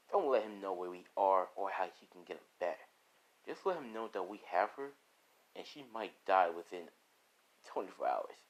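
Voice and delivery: low, soft, ominous orders; quietly sinister villain boss; scheming villain. Acted. Villain dictates ransom message.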